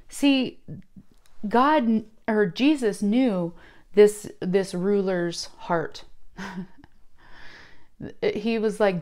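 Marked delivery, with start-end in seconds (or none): none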